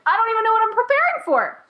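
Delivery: high, quick protest; exasperated bewilderment. Retold or imitated. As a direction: reenact being clueless, voice shooting up. Acts out her exasperated reply.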